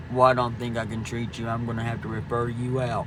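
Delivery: low, dismissive doctor brushing her off; imitating the doctor. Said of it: "looks at me and said".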